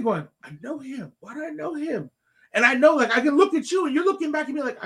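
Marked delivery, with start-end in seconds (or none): 0.4-2.1 high, soft wondering to oneself; acted-out puzzlement, pitched up and softened; puzzled musing. Dad trying to place a familiar coach, high soft puzzlement.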